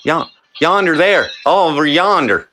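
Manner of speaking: high, sing-song Southern twang; hammed-up Southern accent, pitched high. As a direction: exaggerate a country twang on yonder. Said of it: "You know what the word yonder is".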